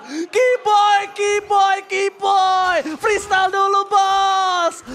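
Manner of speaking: high, loud chanted name; excited shout. Real, not acted. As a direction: hype the player's name. Caster hype at big fight.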